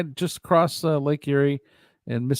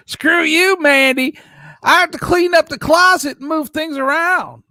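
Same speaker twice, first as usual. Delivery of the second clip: loud, high-pitched mock rant; exaggerated high, loud screechy complaint; Tiffany; 13 semitones higher, 8 dB louder than usual. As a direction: shout it in a much higher joke voice. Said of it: "That's not how she talks. I'm just joking".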